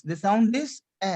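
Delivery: "A" as an open eh; grave-accent E. Shown as a demonstration. Teacher repeats after pen down please, grave-accent E. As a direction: end on "A" said as French è. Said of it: "it is going towards left hand side".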